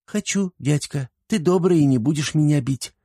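put-on high, matter-of-fact child voice; calm little girl. A narrator's reading. Girl calmly telling uncle she would marry him, childlike. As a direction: answer high and untroubled like a child. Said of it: "спокойно ответила девчушка".

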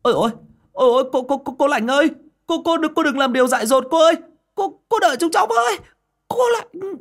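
high, loud, fast stammering cries; frantic calling out. Acted. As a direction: call after her desperately. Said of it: "vừa gọi với".

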